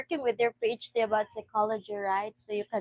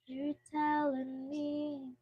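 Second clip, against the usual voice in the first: slow drawn out; 5 semitones higher, 6 dB quieter, much slower than usual. Acted. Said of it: "Are you telling me I'm wrong?".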